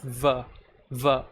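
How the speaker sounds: "V" said as a punched "vuh"; isolated V sound. Shown as a demonstration. Co-teacher isolating the sound, "V" as "vuh". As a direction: sound out "V" as "vuh", teeth on lip. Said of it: "V sound is really different".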